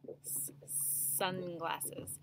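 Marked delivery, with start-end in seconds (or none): 0.1-0.5 a quiet hissed S on its own; just the S sound, held; isolated first sound. Shown as a demonstration. Teacher to class, isolating the opening sound. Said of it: "hear the first sound a little bit more".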